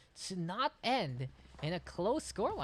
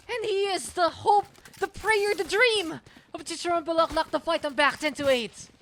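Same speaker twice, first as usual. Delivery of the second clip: high, loud, grand proclamation; triumphant hype; 13 semitones higher, 11 dB louder than usual. Real, not acted. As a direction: roar it like an epic victory speech, easing slightly at the end. Grand victory call after clutch.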